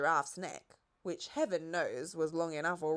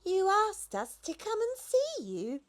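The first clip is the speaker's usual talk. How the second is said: much higher, light giraffe voice; 13 semitones higher, 4 dB louder than usual. A narrator's reading. The giraffe reminds the Duke of his invitation in a high voice. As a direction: play the lofty giraffe.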